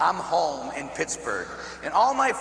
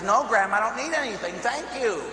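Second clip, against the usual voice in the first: higher-pitched, protesting no; insistent refusal; 7 semitones higher than usual. Retold or imitated. Grandson refusing grandmother's money, insistent. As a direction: turn down the offer with rising insistence.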